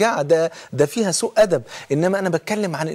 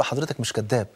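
lower, softer steady answer; soft calm reply; 5 semitones lower, 6 dB quieter than usual. Retold or imitated. Prisoner calmly answering the shouting officer, soft. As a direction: stay calm and soft against his anger. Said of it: "قلت له".

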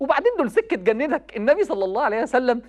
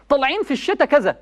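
raised, pitched-up sermon voice; impassioned preaching; 4 semitones higher than usual. Acted. Preacher urging young people, impassioned. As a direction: preach it high and urgent to the crowd. Preacher warns youth about mothers.

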